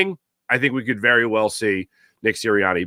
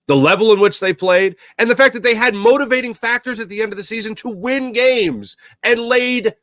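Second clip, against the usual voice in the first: heated rant; 10 semitones higher than usual. Real, not acted. The host ranting to fans about the team laying duds, rising heated shout. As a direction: vent the frustration, pitch climbing.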